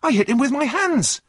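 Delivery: high, loud astonished protest; surprised. A narrator's reading. Runaway denying the chair leg to the driver, surprised. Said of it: "replied John in surprise".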